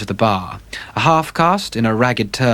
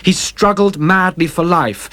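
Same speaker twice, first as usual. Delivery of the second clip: usual speaking voice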